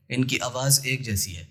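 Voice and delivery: ordinary delivery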